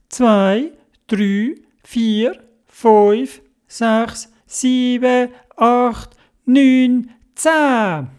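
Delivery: high, evenly spaced count, climbing; suspenseful counting. Acted. All animals count to ten before crossing, building anticipation. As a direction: count up number by number, pitched high.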